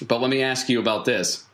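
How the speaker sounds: usual speaking voice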